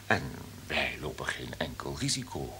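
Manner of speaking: unvoiced conspiratorial whisper. Boss whispering the plan to his assistant. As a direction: whisper it so nobody overhears. Conspiratorial aside in radio play.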